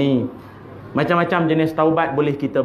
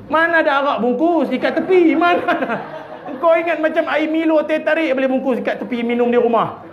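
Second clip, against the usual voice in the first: high, laughing scoff at the idea; laughing disbelief; 8 semitones higher than usual. Real, not acted. Preacher laughing at the ustaz asking to wrap arak. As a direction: laugh it off as absurd.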